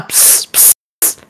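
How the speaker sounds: unvoiced whispered psst. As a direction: hiss it secretly to a friend. Secretly calling Romain aside.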